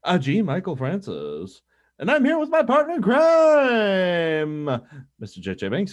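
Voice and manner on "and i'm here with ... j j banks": announcer voice. Acted. Host introduces co-host.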